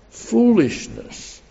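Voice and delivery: drawn-out Foolishness. lifted high then falling; slow high sigh. Real, not acted. Preacher lamenting folly to congregation, slow sigh. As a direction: stretch Foolishness. slowly with a sigh.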